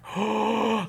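sharp high gasp of shock; imitated gasp. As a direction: gasp like a room full of startled lads. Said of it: "like that".

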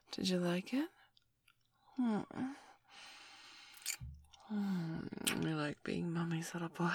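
soft, hushed whispering after the peak; afterglow whisper. Acted. Whispering softly to her partner after the climax. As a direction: murmur it gently, winding down.

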